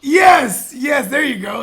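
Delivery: loud, high burst of agreement; excited shout. Real, not acted. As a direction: yell yes in delight.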